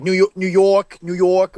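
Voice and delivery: put-on New York vowels; New York accent. Shown as a demonstration. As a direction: try out a New Yorker's accent. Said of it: "He's pretty good".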